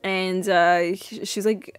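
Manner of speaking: usual speaking voice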